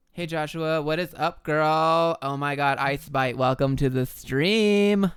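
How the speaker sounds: put-on loud, gushing Valley Girl greeting, stretched out. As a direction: squeal hello to viewers like a Valley Girl. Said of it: "everybody just loves the Valley Girl voice".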